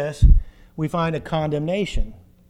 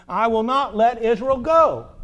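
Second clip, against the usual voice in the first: defiant refusal; 5 semitones higher, 6 dB louder, slower than usual. Acted. Pharaoh's defiant refusal voiced.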